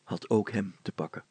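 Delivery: ordinary delivery, nothing marked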